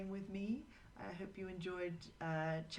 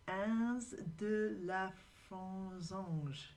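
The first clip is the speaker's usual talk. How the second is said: French accent; 3 semitones higher, much slower than usual. Shown as a demonstration. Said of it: "Please don't laugh at my French accent".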